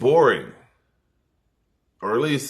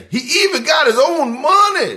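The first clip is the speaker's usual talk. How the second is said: squeaky high loud comic voice, much higher; high movie character voice; 7 dB louder than usual. Retold or imitated. Comic quoting Coming to America, high squeaky voice.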